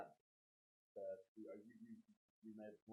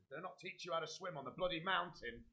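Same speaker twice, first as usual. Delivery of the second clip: raised, higher scolding voice; acted scolding; 9 semitones higher, 6 dB louder than usual. Character berates Phoebe over swimming, acted scolding. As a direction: tell off a companion in exasperation, louder and higher.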